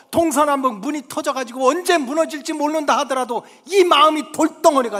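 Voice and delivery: high, loud fervent appeal; preaching at full force. Real, not acted. Preacher pleading with congregation, loud. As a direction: preach it out with rising urgency.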